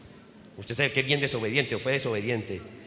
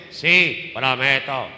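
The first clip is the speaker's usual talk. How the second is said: lightly acted solemn promise; a firm, loud vow to the bishop; touch of ceremonial pledge; ordinand's vow; 2 semitones lower, 7 dB louder, slower than usual. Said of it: "Y uno dice".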